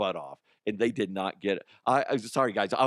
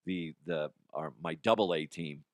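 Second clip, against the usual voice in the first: ordinary talk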